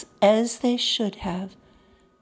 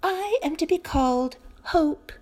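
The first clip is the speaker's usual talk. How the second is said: higher, bright fairy voice; sunshiny fairy; 8 semitones higher than usual. A narrator's reading. Hope introducing herself to Pandora, higher and sunny. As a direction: announce yourself like sunshine. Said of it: "answered the sunshiny figure".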